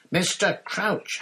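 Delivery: high, loud, slow urgent shout. A narrator's reading. Harry shouting at Snape to be heard. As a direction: hammer the name at him. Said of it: "Harry shouted".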